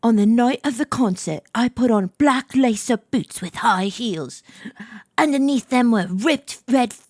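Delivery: put-on amateur British accent, measured narration. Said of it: "I gotta do my British accent".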